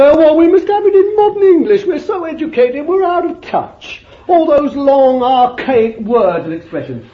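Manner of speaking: high, fast, prissy mock voice; lampooning a modern churchman, high and quick; mocking modern preacher. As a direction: send up an educated cleric. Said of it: "He didn't say".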